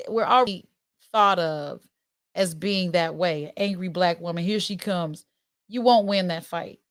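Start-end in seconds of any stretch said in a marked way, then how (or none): none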